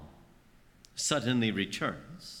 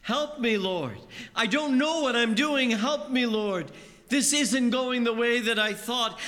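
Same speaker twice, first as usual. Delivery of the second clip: desperate cry; 8 semitones higher than usual. Acted. Sinking believer crying out to Jesus, desperate. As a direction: beg like you're going under. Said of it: "we cry out".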